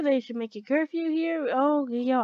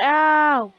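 drawn-out loud ow; loud yelp of pain; 4 semitones higher, 10 dB louder than usual. Real, not acted. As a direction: yelp loudly at a hit. Yelps when hit in game.

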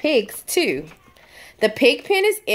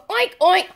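high squeaky piglet oink; a higher, playful piglet oink; 7 semitones higher than usual. Pretend.

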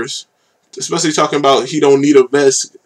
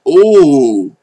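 impressed ooh; 3 semitones higher, 6 dB louder than usual. Real, not acted. Reacting to a hard bar with a loud impressed ooh. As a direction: let out a big impressed ooh. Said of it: "I'm like".

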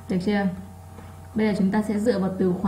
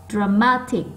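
MA in "dramatic" punched; stressed second syllable; slower than usual. Shown as a demonstration. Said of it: "nó to hơn những âm còn lại". (nhấn mạnh âm hai của "dramatic")

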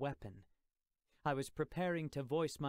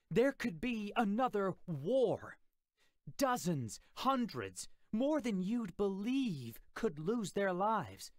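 much higher, louder furious yell; furious shouting; 7 semitones higher, 5 dB louder than usual. Acted. Uncle yelling at Jim about the coming war, furious. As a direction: unload all your rage on him.

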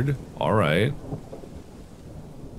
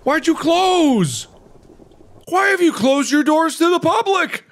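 mock outraged wail; 3 dB louder than usual. Player protesting at doors closed to the public, outraged wail. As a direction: wail it high, dragging the words out.

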